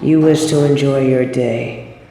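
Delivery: calm them gently to sleep; hushed tender soothing; soothing lull. Acted.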